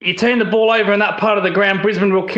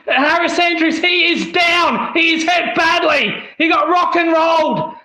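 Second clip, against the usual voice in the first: high excited shouting call; wrestling commentator; 6 semitones higher than usual. Real, not acted. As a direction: yell it like a ringside caller. Live play-by-play of a big hit.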